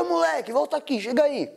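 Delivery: put-on high-pitched call to a kid walking off; calling someone back. Acted man calling him back.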